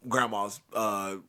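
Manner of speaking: ordinary talk, nothing marked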